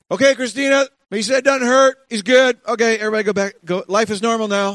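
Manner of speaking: high, loud breezy dismissal; mock brisk dad. Dad brushing off his hurt son, loud and high. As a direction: wave everyone off cheerfully, pitched high and loud. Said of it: "I would say".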